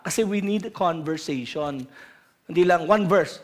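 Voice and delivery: usual speaking voice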